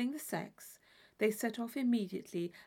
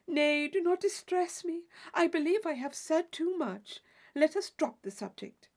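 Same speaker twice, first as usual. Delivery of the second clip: flustered; 5 semitones higher, 3 dB louder than usual. Acted. Isabella feigns embarrassment.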